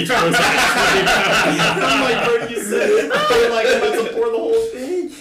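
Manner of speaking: laughing. Real, not acted. Laughing retelling the absurd request.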